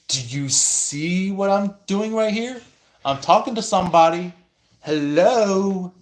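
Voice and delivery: high, loud, fast exasperation; exasperated. Retold or imitated. Speaker telling the intruder off, high and exasperated. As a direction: brush them off, fed up. Annoyed at being interrupted.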